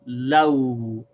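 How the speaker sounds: "law" said slowly, la closing on w; slow demo law. Shown as a demonstration. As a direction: draw out "law" for learners. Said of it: "So we say law".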